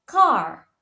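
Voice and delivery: car with a relaxed English R; car, no roll on the final R; untrilled R. Shown as a demonstration. Student teacher showing the English R in car, untrilled R. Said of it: "we pronounce without thrilled or more relaxed".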